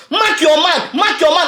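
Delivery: frantic fan yelling at a defender; retold yell at the TV. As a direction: yell it like you're screaming at the TV. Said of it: "I was screaming my T V".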